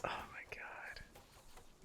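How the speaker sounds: breathy whispered aside. Real, not acted. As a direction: whisper it under your breath. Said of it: "E-grill voice".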